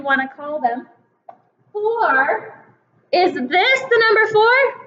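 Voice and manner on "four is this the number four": "Is this the number four?" asked high, with a rising tune; wrong guess. Shown as a demonstration. Teacher testing kindergartners with a wrong card, "Is this the number four?" high and rising. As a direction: ask "Is this the number four?" as a higher, doubtful check. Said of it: "No, that's the number three".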